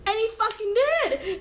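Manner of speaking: indignant exclaim. Real, not acted. Confirming her hunch came true.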